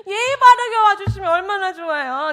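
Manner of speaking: high, loud, playful grumble; comic complaint. Retold or imitated. Helper grumbling to the Lord, loud comic complaint. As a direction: protest loudly, on the edge of laughter. Repeats comic protest to God.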